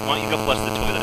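normal talking voice

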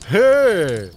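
high, loud hey as a cheerful shout; loud greeting. Acted. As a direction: call out a hearty hey. Lester calls out greeting. (lauter, freudiger Zuruf)

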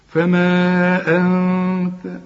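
فما أنت drawn out very slowly; long madd. Shown as a demonstration. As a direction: stretch فما four beats before أنت. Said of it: "مد أربع حركات". (مد طويل ببطء في فما أنت)

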